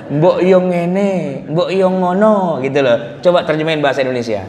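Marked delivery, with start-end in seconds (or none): 0.1-2.6 very slow Javanese "Mbok yo ngene, mbok yo ngono", each "yo" falling; Javanese idiom. Shown as a demonstration. Showing the audience an untranslatable Javanese phrase, slow Javanese lilt. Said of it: "Misalnya ya".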